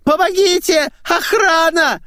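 very high, loud shrieking falsetto; squealing in falsetto. Acted. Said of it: "завизжала фистулой".

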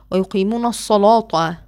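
الصلاة with a thick dark emphatic l, slow; heavy l in الصلاة. Shown as a demonstration. Teacher demonstrating Warsh's heavy l. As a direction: recite ويقيمون الصلاة with a full heavy l. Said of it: "رواية ورش هي".

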